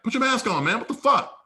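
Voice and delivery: high, loud indignant demand; retold exasperation. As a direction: replay snapping at a stranger. Retold confrontation, staring him down.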